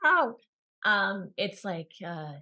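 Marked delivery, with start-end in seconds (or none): 0.1-0.4 high, loud "ow"; mock sharp cry of pain; pain yelp. Shown as a demonstration. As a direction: yelp in exaggerated hurt. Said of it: "it's not actually being like".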